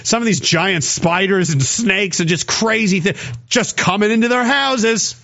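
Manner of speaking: ordinary talk, nothing marked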